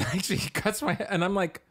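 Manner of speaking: normal talking voice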